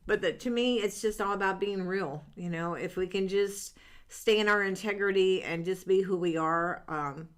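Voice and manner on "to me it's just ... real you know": ordinary talk, nothing marked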